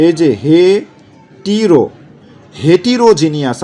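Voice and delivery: হেটিরো with an i sound, slowly; হেটিরো drawn out, then হেটিরোজিনিয়াস; memory pronunciation. Shown as a demonstration. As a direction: say হেটিরোজিনিয়াস as হেটিরো plus জিনিয়াস. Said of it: "আপনি এরকম করে মনে রাখবেন".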